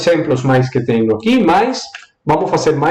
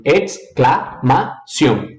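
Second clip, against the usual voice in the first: "exclamação" split in four, Spanish ex-cla-ma-ción; broken into syllables; much slower than usual. Shown as a demonstration. Teacher breaks the word for students, syllable by syllable.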